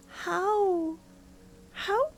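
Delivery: usual speaking voice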